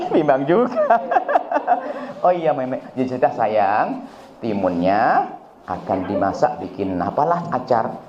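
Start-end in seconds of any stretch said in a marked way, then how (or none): 1.0-2.2 high burst of laughter. Real, not acted. Preacher laughs at example. 2.3-7.9 gentle mother. Retold or imitated. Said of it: "enak begitu".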